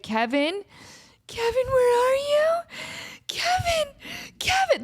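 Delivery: high-pitched plaintive call for a lost pet; re-enacted frantic searching cry; desperate calling. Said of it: "that desperate".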